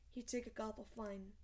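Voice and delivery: usual speaking voice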